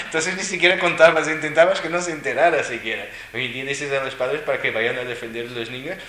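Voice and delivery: ordinary delivery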